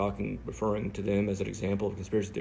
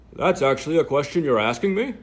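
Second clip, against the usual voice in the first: own voice, mocked; 6 semitones higher, 12 dB louder than usual. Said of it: "doing her voice and my voice".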